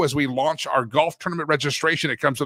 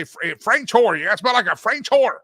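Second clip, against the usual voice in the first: imitating a Texan dad, higher-pitched Southern twang; Southern accent; 6 semitones higher, 3 dB louder than usual. Son mimicking his dad's complaint about perfume, Southern accent. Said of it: "but he'd say".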